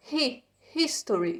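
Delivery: "history" with a breathed H at the start; H sounded. Shown as a demonstration. Teacher drilling the English H for students, H sounded. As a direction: sound out "Hi" first, then "history" with the H aspirated. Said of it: "pronuncia o H com som de R".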